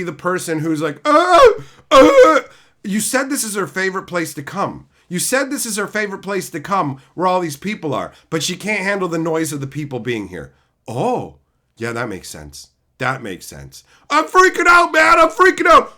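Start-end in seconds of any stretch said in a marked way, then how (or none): 1.0-2.4 mock panicked stammer. Imitating panicked character. 14.1-15.9 mock panic. Imitating character panicking.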